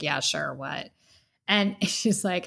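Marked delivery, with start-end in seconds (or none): 0.0-0.9 low, soft imitation of Chris; lightly voiced Chris, casual and low; imitating Chris. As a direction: drop low and quiet as Chris. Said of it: "And he's like".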